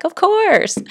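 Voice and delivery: high, loud, obvious agreement; emphatic realization. Acted. Now looking back on the healer's words, emphatically agreeing. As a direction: exclaim it as wholly obvious, falling at the end. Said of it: "now I'm like".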